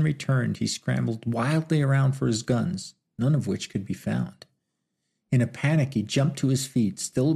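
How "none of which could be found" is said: ordinary delivery, nothing marked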